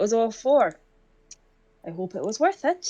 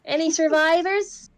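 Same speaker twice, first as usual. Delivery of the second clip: calling out; 9 semitones higher, 5 dB louder than usual. Acted. Calling out in a ruin.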